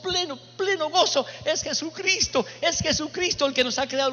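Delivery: fervent preaching. Retold or imitated. Priest testifying to the hostile man about Jesus, fervent and high. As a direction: lift the pitch and press the joy home.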